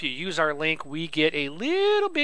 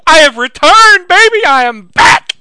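loud, high triumphant yell; excited shout; 13 semitones higher, 18 dB louder than usual. Real, not acted. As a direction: announce your return with a roar. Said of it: "I am so excited".

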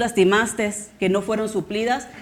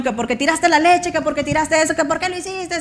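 mock-scolding parent, a bit higher; scolding a child; 6 semitones higher than usual. Parent scolding child over spilled milk, mock scold. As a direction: nag the kid over spilled milk, half-acted. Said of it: "Es puro gritarles".

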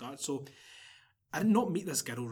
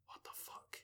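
whispered disbelief, almost no voice; shocked whisper; 21 dB quieter, slower than usual. Retold or imitated. Storyteller replaying his reaction to her message, shocked whisper. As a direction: breathe it out, replaying your own shock.

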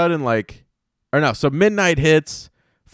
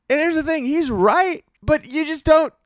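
much higher, incredulous protest; shocked; 13 semitones higher than usual. Real, not acted. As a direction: protest in high-pitched disbelief. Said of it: "I was shocked".

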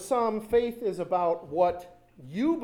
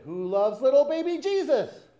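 high, loud mock shout of pageant kids; imitating children's shouted chant; shouted question; 9 semitones higher, 8 dB louder than usual. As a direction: call it across the aisle, pitched right up. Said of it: "then they would repeat it".

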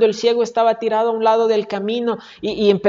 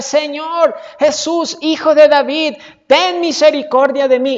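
crying out; 6 semitones higher, 5 dB louder than usual. Acted. Blind man by the road begging Jesus for mercy, crying out. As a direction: call out desperately for mercy. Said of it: "empezó a gritar".

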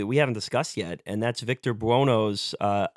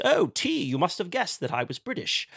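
mock British accent; 7 semitones higher than usual. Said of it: "now he's playing up this".

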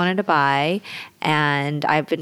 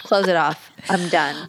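normal talking voice

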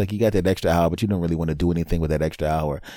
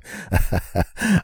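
acted snickering laugh; sneaky laugh; 2 semitones higher than usual. Hidden husband trying not to laugh, sneaky laugh. Suppressed gloating as she turns.

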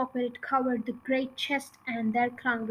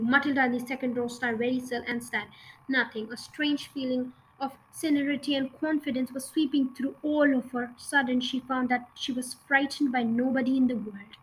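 ordinary delivery, nothing marked

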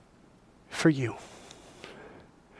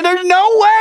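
overjoyed disbelief; 6 dB louder than usual. Retold or imitated. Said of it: "He starts crying".

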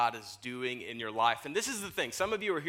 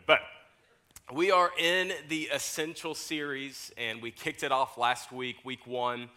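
ordinary talk

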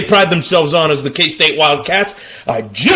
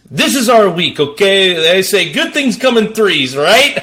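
usual speaking voice

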